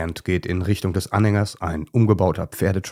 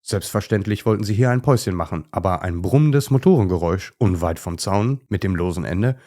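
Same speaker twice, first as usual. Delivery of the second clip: ordinary delivery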